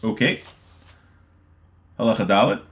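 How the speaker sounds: ordinary talk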